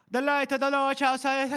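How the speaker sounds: loud, very high scripture proclamation; mock zealous preacher. Podcaster imitating Ishri quoting her scriptures, mock zealous preacher. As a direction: belt out the holy words, hammed up. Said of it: "And then Ishri gets all".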